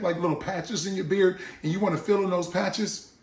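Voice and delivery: ordinary delivery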